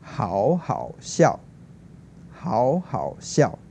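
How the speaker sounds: 好好笑 repeated very slowly with careful tones; slow clear tones. Shown as a demonstration. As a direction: echo 好好笑 slowly like a teacher. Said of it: "which means very funny".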